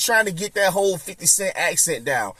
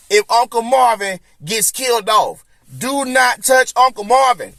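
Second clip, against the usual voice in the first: higher, louder, firm insistence; loud demand; 6 semitones higher, 4 dB louder than usual. Real, not acted. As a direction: put your foot down for the character. Demands Uncle Marvin stays.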